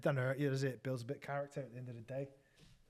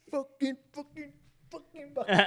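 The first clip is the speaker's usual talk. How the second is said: high, strained exasperated outburst, louder on bucket; mock fury; 12 semitones higher, 6 dB louder than usual. Apprentice fed up after the pub prank, high mock outburst. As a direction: imitate a fuming apprentice swearing at the bucket. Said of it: "straight away he went".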